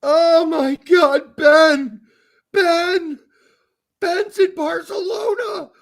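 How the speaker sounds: high, loud hammed-up sobbing; mock wail. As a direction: wail his name in fake despair. Mock wailing over a player.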